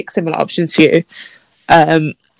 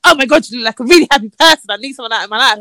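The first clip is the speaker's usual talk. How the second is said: quick, higher mock gushing; put-on enthusiastic voice; 5 semitones higher, faster than usual. Repeating his message as she hears it, mock enthusiastic. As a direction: parody an over-eager match, words tumbling out. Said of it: "that's how I'm reading it".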